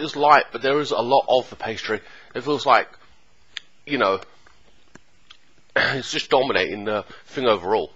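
speaking between bites; chewing while talking. Real, not acted. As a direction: mouth partly full. Seen 2: Holds pie piece at mouth, bites and chews between phrases throughout.

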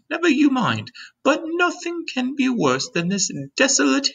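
high-pitched, gentle lady's voice; kind ladybug voice. A narrator's reading. Ladybug standing beside James to explain, kind high voice. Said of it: "obviously a kind and gentle creature".

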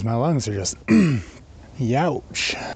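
raspy, strained throat; hoarse voice. Real, not acted. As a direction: voice cracking and rough. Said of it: "what happened to my voice?".

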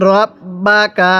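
very slow, drawn-out syllables; model recitation. Shown as a demonstration. Teacher models Quran words for learners, slow measured recitation. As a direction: stretch each long vowel evenly. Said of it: "আমার সাথে পড়ুন".

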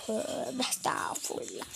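slow whispered tövbe estağfurullah; hushed startled whisper. Real, not acted. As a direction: whisper it under your breath, startled.

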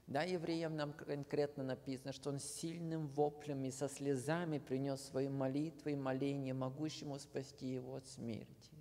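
ordinary talk, nothing marked

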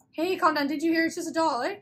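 exasperated. Acted. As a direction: snap at a panicking friend to calm down. Acted quarrel over a frightening doll.

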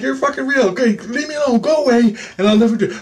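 high, strained begging to be left; frantic pleading. Retold or imitated. Begging the alien to go away, frantic high plea.

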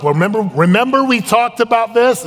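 high, loud comic pleading; mock defensive husband. Husband defending himself to his wife, mock defensive.

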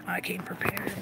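barely voiced, quiet aside; whispered repeat. Real, not acted. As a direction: say it under your breath. Same words repeated under breath.

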